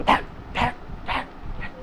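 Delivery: sharp voiceless smacking sounds; imitated whacks. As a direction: mimic repeated blows with a shoe. Imitates shoe striking the wing.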